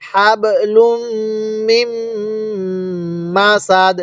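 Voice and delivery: long hums on লুম and মিম, crisp stop on সাদ; slow model reading. Shown as a demonstration. Teacher having learners read along, hummed লুম and মিম. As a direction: hold each m nasally, pause between word groups. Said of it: "তাহলে পড়ুন".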